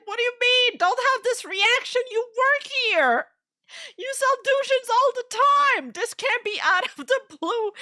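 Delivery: high-pitched near-crying protest, as if pleading; high mock whiny protest. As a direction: whine it in a high voice, like a wail. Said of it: "I'm like".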